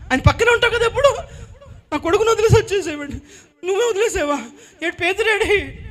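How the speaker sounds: high, laughing scolding; laughing reproach. Acted. Mother mocks John for leaving her son, laughing. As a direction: tease through laughter.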